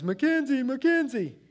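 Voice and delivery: high, loud wail of a name; young son screaming his sister's name; crying child screaming. Retold or imitated. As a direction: howl her name like a hurt little boy. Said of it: "and he's screaming".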